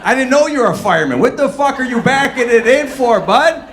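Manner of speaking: loud, high mock rant at a driver. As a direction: yell it like a fed-up driver. Ranting at a parking driver.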